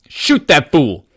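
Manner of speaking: slow, loud barked command; shouted order. Acted. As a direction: bark the order to shoot. Robber ordering a shot.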